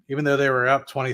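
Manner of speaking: usual speaking voice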